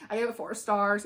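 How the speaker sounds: ordinary talk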